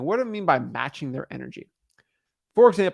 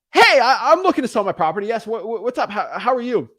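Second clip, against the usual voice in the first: loud, high-pitched burst of excitement; hyped-up, extreme energy; excitable seller; 7 semitones higher, 5 dB louder than usual. Acted. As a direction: play an over-eager property seller calling in. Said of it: "If someone's excitable".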